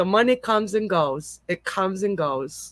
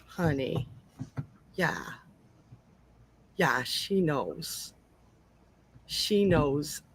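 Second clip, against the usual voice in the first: choked up; 3 semitones lower, 7 dB quieter, slower than usual. Real, not acted. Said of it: "sorry for getting all sniffly".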